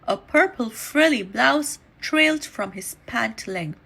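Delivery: ordinary delivery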